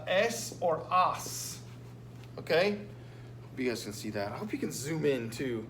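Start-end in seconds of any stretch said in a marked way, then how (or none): 0.1-1.6 slow spelling. Shown as a demonstration. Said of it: "we're gonna either add".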